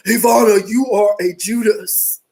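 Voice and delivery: lightly voiced menacing accuser; a hint of a possessed demon voice. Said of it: "I have to say it like her".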